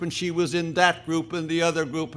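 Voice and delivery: ordinary delivery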